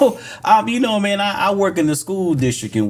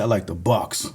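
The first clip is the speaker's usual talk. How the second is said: low mock Ukrainian accent; imitated Ukrainian accent; 9 semitones lower, 4 dB quieter than usual. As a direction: put on the kid's Ukrainian accent, pitched down. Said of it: "he talked like".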